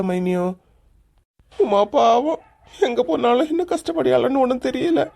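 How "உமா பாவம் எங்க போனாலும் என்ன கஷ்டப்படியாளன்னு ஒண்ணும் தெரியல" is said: crying. Real, not acted. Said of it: "இப்ப அழுது என்ன பிரயோஜனம்".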